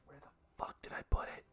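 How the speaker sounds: whispered to self; muttered whisper while searching. Real, not acted. DM searching for notes.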